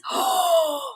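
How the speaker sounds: sharp high gasp; quick thrilled intake of breath; excited gasp. Real, not acted. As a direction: gasp in delight.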